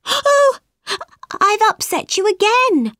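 high, alarmed "oh" of realisation; gasp, then high-pitched regret; gasping dismay. Acted. Alice realises she upset the mouse.

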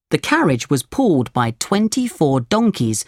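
usual speaking voice

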